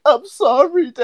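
high-pitched mock sobbing apology; retold crying. Crying kid apologizing to dad, mock tearful whine. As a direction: imitate a little weenie kid in tears. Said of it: "I'm crying".

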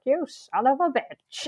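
slow, drawn-out action-hero curse; Arnold Schwarzenegger. Retold or imitated. Retelling the woman's last line of the film, hammy action-hero voice. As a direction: imitate a film star's drawled insult. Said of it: "A bit like Arnold Schwarzenegger does".

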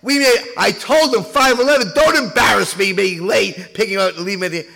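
hammed-up angry husband yelling at wife. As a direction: scold her for making him late. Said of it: "And the husband says".

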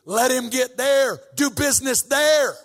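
loud, high preaching that keeps building; fiery preaching. Acted. Preacher urging the church to tear their hearts, fiery preaching. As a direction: preach it hard, pushing the congregation.

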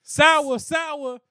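high, loud hawking call; corner store guy. Retold or imitated. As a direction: shout it like a street vendor.

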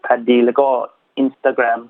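ordinary talk, nothing marked

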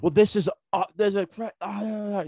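high, sputtering mock tirade; imitated yelling. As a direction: sputter into babble like an irate customer. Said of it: "She's just, like, yelling and everything".

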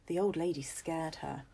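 usual speaking voice

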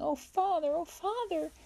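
put-on very high, distressed child's cry; alarmed cry. A narrator's reading. Alice to Father as her brother is hurt, alarmed cry. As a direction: call out to a parent in alarm, pitched right up.

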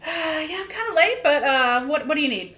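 high-pitched, loud, flustered reply; hurried latecomer. Pretend. Casper stopped by Fiona while running late, flustered. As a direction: answer high, as if stopped mid-rush.

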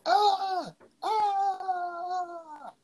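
high panicked cries; terrified screaming. Retold or imitated. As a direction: scream in terror. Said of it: "didn't feel any type of that way".

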